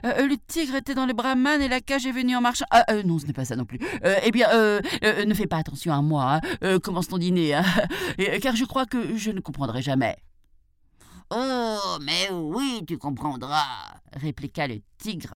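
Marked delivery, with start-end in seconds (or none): 0.0-10.2 flustered, stammering jackal in mock panic; feigned trembling fear. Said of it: "en faisant semblant de trembler de peur". 11.3-13.6 drawn-out, menacing tiger retort; enraged tiger. A narrator's reading. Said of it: "enragé par la stupidité du chacal".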